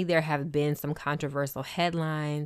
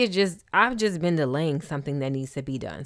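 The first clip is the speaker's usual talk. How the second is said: usual speaking voice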